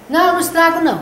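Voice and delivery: a lightly voiced stubborn toddler; child protesting. Grandson denying he breaks things, mildly voiced child protest.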